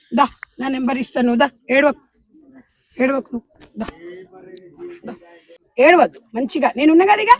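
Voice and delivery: comforting. Real, not acted. Comforting a crying girl.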